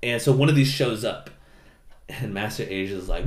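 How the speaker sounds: usual speaking voice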